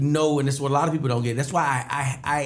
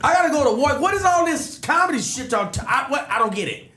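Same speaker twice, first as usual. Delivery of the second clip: high, loud, baffled protest; incredulous outburst; 13 semitones higher, 3 dB louder than usual. Retold or imitated. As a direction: shout it like nobody's making sense. Retold confusion at crowd praise.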